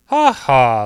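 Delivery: big booming ha ha; loud mocking laugh. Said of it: "laughed the lion".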